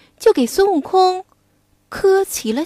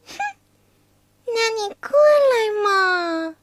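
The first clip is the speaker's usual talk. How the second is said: coy maiden; 7 semitones higher, 4 dB quieter than usual. Acted. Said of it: "Wukong disguised as Cuilan teasing".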